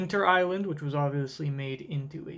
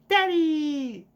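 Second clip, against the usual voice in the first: put-on child calling daddy; 11 semitones higher, 4 dB louder than usual. Voices Bonnie calling her dad.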